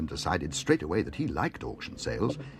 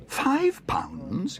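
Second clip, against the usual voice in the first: shocked exclamation; 12 semitones higher than usual. Acted. Bear shocked at the price he owes. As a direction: gasp out the price in disbelief. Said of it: "exclaimed Paddington".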